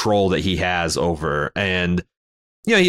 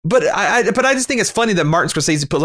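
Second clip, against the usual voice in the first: ordinary talk, nothing marked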